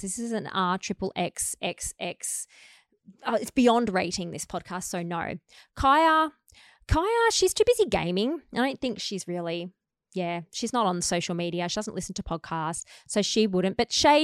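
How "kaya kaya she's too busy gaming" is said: high playful call. Pretend. Calling out to gaming daughter.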